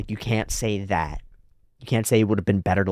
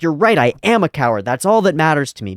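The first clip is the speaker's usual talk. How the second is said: defiant character; 6 semitones higher, 6 dB louder than usual. Retold or imitated. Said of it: "He's like".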